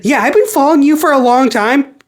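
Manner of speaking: a touch of a cheerful little fan; eager Tails. Retold or imitated. Tails popping up to greet Sonic, lightly high eager voice. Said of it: "and says".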